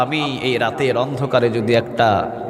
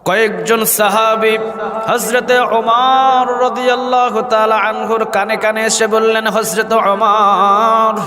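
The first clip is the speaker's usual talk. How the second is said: high, drawn-out chanting delivery; melodic preaching; 9 semitones higher, 4 dB louder, slower than usual. A narrator's reading. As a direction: intone the holy name, stretching it long. (সুর করে টেনে টেনে ওয়াজ)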